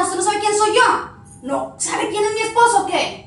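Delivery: slow, imperious narco-wife threat; haughty threat. Acted. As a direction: intimidate the officer.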